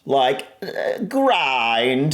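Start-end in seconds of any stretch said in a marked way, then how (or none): 1.1-2.1 "grind" with a long, stretched vowel; slow, elongated "grind"; drawn-out vowel. Shown as a demonstration. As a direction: draw out the middle of "grind". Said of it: "it's the drawn out".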